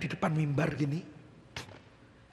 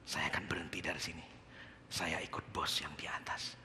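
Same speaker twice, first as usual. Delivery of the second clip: whispered resolve; 10 dB quieter than usual. Retold or imitated. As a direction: breathe it out like a secret decision. Quietly telling boss he quits. (berbisik pelan dan mantap)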